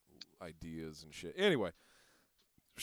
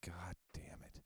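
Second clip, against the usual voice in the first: whispered under his breath, fed up; a frustrated whisper at the gas station pump; exasperated whisper; 4 semitones lower, 15 dB quieter than usual. Retold or imitated. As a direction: breathe it out in quiet annoyance. Muttering to himself at gas station.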